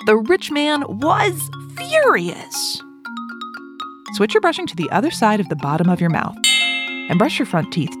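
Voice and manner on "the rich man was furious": very slow, stretched-out storytelling with a high swoop; slow, higher-pitched dramatic narration; dramatic emphasis. A narrator's reading. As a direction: draw the words out for suspense. Narrator dramatises the rich man's fury.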